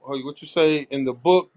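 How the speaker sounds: ordinary delivery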